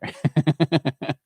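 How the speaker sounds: bright burst of real laughter. Real, not acted. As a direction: laugh at your son's cheeky tip. Laughing at son's advice.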